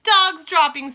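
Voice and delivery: high, loud Dog's droppings shout. Acted. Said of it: "they shouted".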